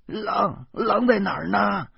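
put-on high, frightened old mother's question; startled alarm. A narrator's reading. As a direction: jolt awake in a panic. Mother startled awake by wolf alarm. (惊慌地高声问)

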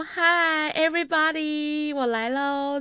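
high, loud, stretched-out hello; awkwardly over-hearty greeting. Retold or imitated. Answering the manager before everyone, awkwardly exuberant. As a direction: announce yourself to the whole room, overdone. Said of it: "这样子就很尬的".

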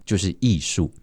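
ordinary talk, nothing marked

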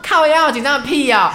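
loud, laughing, high scoff; exasperated retort. Retold or imitated. Woman retelling her reply to nervous police, loud laughing scoff. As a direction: laugh it off, fed up.

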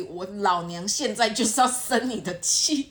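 slow, stubborn declaration; defiant. Retold or imitated. Angry girlfriend defiantly declaring her grudge, slow. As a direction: draw it out like you refuse to back down. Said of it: "你已经不管三七二十一".

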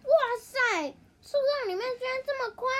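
high, loud wide-eyed wow; amazed. Acted. Hippo steps into the tree hole, amazed exclaim. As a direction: marvel at the huge hollow.

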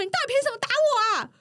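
high, aggrieved mock protest; lightly voiced whiny outraged coworker; imitating angry coworker. Beaten coworker protests being hit, mock angry and high. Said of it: "然后他就很生气，他说".

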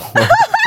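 embarrassed squealing giggle; high giggle. Real, not acted. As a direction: burst into a shy laugh. Embarrassed laugh.

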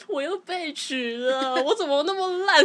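slow, then rising into a tearful wail on 我怎么那么烂; mock-sob the self-blame, pitch climbing; retold crying complaint. Telling mom about failing the exam again, in a retold tearful wail. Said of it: "我就边哭边跟她说".

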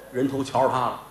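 plain reading voice

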